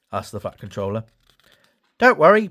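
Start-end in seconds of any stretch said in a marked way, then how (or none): 2.0-2.5 higher, louder cheerful engine; Whiff reassuring. A narrator's reading. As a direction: Whiff reassures the Fat Controller. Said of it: "Puff whiffed".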